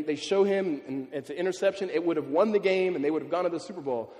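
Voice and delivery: ordinary talk, nothing marked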